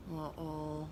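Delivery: soft worried uh-oh; whisper. Acted. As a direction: breathe it out in worry. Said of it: "whispered the kids".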